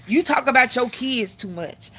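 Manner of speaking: normal talking voice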